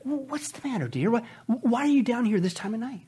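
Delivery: soft hushed question to her husband; wife whispering to her husband in the night kitchen. Acted. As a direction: ease the words out in a hush so you won't startle him. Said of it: "she whispers as she steps in the room".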